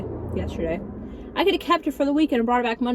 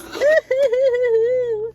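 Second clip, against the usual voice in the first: a sudden shrill cackle; high squealing laugh; 13 semitones higher, 5 dB louder than usual. Real, not acted. Laugh after chicken minis.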